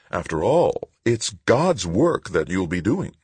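high-pitched official lecturing; patronizing lecture. A narrator's reading. Woman official to Tavalera on his duty, patronizing.

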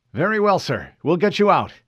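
Fred reporting to an unknown officer, formal. Acted. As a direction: state rank crisply. Fred agrees.

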